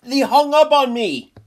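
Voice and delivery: high, loud outraged yelp; indignant outburst. Real, not acted. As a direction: shout it in disbelief. Outraged at being cut off.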